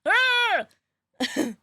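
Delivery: high pirate-like arrgh of frustration; frustrated arrgh yell. Real, not acted. Streamer vents after panicking in the game, arrgh yell. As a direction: let out a comic groan-yell.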